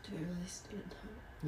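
fragile tearful whisper. Acted. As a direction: ask it in a whisper. Whispered question.

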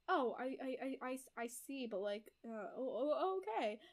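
mock-flustered, halting backpedal; stammering realization. Retold husband taking in wife's explanation, stammering. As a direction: trip over the words, trailing off. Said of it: "And he's like".

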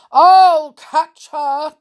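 big, slow, loud bear cry; Father Bear's shout. A narrator's reading. Father Bear chasing Goldilocks, shouting. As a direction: roar it out, stretching the words. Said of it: "cried Father Bear".

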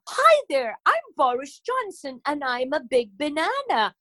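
mock posh English accent, pitched high; posh Boris Johnson accent. Shown as a demonstration. Comedian shows the accent she avoids, mock posh English accent. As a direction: put on a hammed-up posh English politician's accent. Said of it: "I'm not doing it in that accent".

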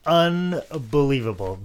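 normal talking voice

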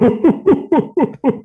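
high-pitched imitated ho-ho chuckle; long run of mock Santa hoots; Santa Claus laugh. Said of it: "Bernard had this laugh. He was kinda like Santa Claus".

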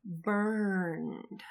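whispered, drawn-out "burned"; ominous hush. Pretend. Podcaster revealing the photo find, ominous whisper. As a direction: let it out as a slow, sinister whisper.

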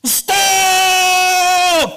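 very loud, high, drawn-out shout; shouted warning. Acted. Girl on lookout warning her dad, shouted. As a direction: yell it out as an alarm. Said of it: "the girl shouted".

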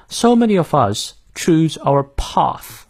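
slow British pronunciation, broad "ah" in path; British accent. Shown as a demonstration. Teacher showing learners the British pronunciation, slow British accent. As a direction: say it in British English, no r in "our". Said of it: "我们来看一下英式发音".

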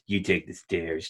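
low, slow, quiet menace; cat girl threatening the guy; menacing order. Retold or imitated. Cat-girl ordering him away.

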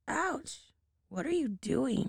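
yelp, then snap back at grandmother; angry cry. Acted. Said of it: "cried the devil angrily".

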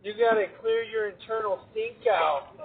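ordinary delivery

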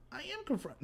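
high, soft little protest; small high-pitched defensive voice; mock meek protest. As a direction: protest meekly, imitating a too-polite friend. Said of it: "you sound like Dick Tracy".

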